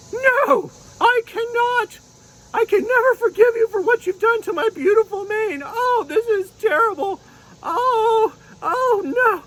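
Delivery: very high, indignant unicorn refusing; little unicorn outraged over her mane; upset unicorn puppet. Pretend. Unicorn puppet refusing the dragon, very high and upset. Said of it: "[name] said".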